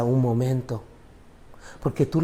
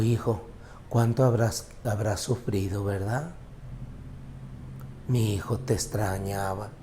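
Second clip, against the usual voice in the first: tender father; 4 semitones lower, 7 dB quieter than usual. Retold or imitated. Father crying, embracing his son.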